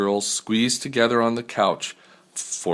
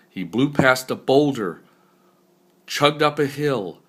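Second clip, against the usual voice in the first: usual speaking voice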